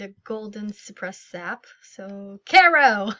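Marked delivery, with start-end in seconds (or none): 2.4-3.1 loud, higher shout of a name; calling out. Acted. Player calling out loudly to Caro to catch her. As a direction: call her from across the square.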